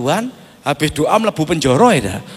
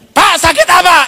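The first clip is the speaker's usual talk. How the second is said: loud question; 12 semitones higher, 9 dB louder than usual. Retold or imitated. Pastor asks sick man.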